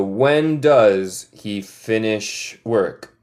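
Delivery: "When does he finish work?" at half speed; slow model question. Shown as a demonstration.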